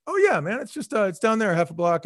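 breezy helpful neighbour; friendly guy. Retold or imitated. Guy on porch gives directions, friendly and relaxed. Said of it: "the guy was like".

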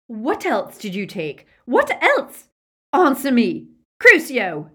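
loud, high, savage demands building to a yelled curse; enraged villain's shriek; furious interrogation. A narrator's reading. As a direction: scream it at a prisoner under torture. Villain interrogating a tortured prisoner.

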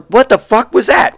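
mock shock. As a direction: recoil at a weird noise. Acted shock at cat's sound.

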